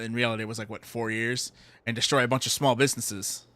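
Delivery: ordinary talk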